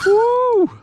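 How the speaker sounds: loud, high whoop; whooping at the catch; joyful whoop. Real, not acted. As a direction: let out a triumphant woo. Another snapper landed.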